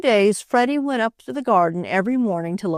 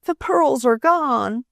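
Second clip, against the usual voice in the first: sobbing; 7 semitones higher, slower than usual. Acted. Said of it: "she sobbed".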